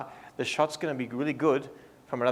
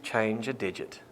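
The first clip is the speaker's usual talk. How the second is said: low, soft, voiced near-whisper; quietly feed a secret prompt into an ear; hushed and low, not fully whispered; mock whisper; 6 semitones lower, 7 dB quieter than usual. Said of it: "I'll be whispering this in your ear".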